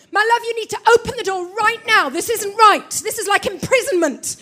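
loud, angry, half laughing; wife shouting through the door at husband, furious; furious demand. Retold or imitated. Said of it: "'Cause I'm just furious".

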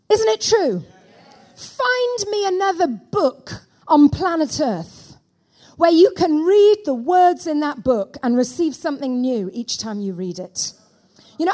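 ordinary delivery

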